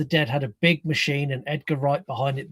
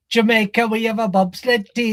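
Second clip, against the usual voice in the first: movie quote; 6 semitones higher, 4 dB louder than usual. Retold or imitated. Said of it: "sat in his living room going".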